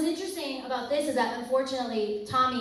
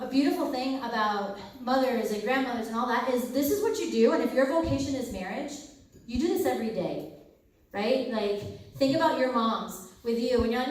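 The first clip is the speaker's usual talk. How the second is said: normal talking voice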